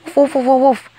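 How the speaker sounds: high, loud barking woofs; yappy dog woofs. Acted. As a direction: bark like an excited puppy. Pet dog character barking.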